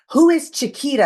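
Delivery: pushy therapist. Retold or imitated. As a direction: press her with the question. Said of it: "She'd be like".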